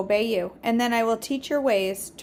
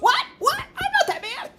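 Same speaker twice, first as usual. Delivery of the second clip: much higher, squeaky protest; high cartoon voice caught in the act; cartoon King Dave. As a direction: squeak out a flustered denial. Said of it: "And King Dave goes".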